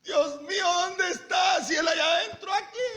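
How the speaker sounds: high, wailed plea in mock desperation; acted desperate calling. Preacher acting a believer searching outside for God, high desperate calling. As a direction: call out to heaven as if unheard.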